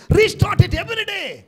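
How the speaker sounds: a fervent preacher's call to start anew each day; preaching shout. Acted. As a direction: ring it out to the whole church, slowly. Preacher exhorting congregation.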